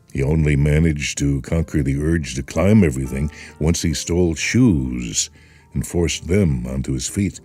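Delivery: usual speaking voice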